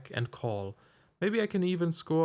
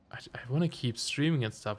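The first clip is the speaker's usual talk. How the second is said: strained whisper. Real, not acted. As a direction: voice fading to a whisper. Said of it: "my throat gives in after a while".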